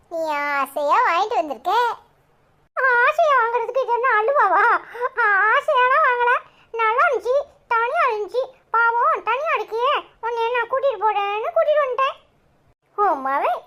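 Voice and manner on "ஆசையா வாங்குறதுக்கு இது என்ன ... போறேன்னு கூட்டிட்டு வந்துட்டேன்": put-on much higher, chirpy small child; little boy voice. Cheeky boy greeting neighbour Lakshmi aunty, high child voice. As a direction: play a cheeky young kid.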